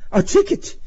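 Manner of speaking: very high, startled voice; timid, high disbelief; dismayed question. A narrator's reading. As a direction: squeak it in alarm. Driver dismayed at ticket.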